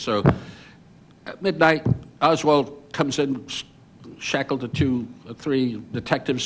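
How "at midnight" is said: usual speaking voice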